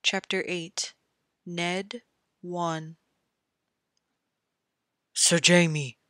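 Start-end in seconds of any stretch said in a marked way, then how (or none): 5.1-6.0 a sharp, louder call of his name; cried out. A narrator's reading. Ned crying out to the fallen knight, loud call. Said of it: "Ned cried again".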